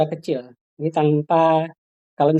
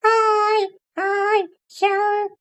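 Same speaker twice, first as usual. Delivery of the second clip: put-on high, slow little child voice; small puppet voice; much slower than usual. Shown as a demonstration. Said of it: "suara boneka". (suara kecil boneka anak)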